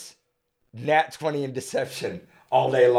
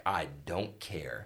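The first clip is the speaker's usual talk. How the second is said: lower, softer, slow indifference; lazy mock brush-off; imitating bored dismissal; 8 semitones lower, 14 dB quieter, much slower than usual. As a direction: brush it off like you couldn't care less. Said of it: "he'll be like".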